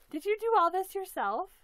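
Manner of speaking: loud and high, bursting with delight; thrilled question. Real, not acted. Unboxing a handmade doll, thrilled loud question. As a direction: shout it out in amazement.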